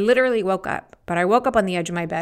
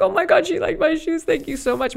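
high, loud gushing thanks; thrilled; 10 semitones higher, 6 dB louder than usual. Retold or imitated. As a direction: gush like a starstruck fan. Thrilled stranger liked her shoes.

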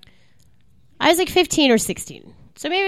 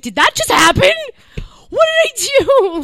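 stunned disbelief; 11 semitones higher, 4 dB louder than usual. Retold or imitated. Fan recalls the hug afterwards, acted stunned disbelief. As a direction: replay your own astonishment.

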